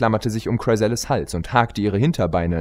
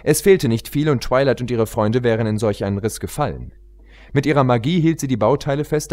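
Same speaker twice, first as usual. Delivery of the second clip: ordinary delivery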